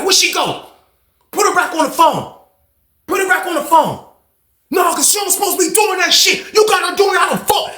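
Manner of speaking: high, raging shout into the phone; angry yelling. Retold or imitated. Banks yelling into the phone for his daughter, raging. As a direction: yell at the baby mama, furious.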